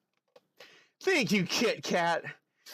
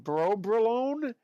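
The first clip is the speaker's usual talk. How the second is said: trying the name; 8 semitones higher, slower than usual. Shown as a demonstration. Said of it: "Bro, I can't even say it".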